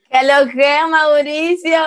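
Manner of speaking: saludo dominicano bien marcado, agudo; ¿Qué lo que, Mauricio? con tono dominicano alto; acento dominicano. Retold or imitated. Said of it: "con ese acento dominicano". (saludo con acento dominicano, pedido por el anfitrión)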